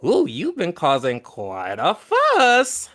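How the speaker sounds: put-on a slow, cackling old hag teasing. Old hag teasing the newcomer Sharp, slow and amused. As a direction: chuckle it out like a knowing old crone. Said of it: "she goes".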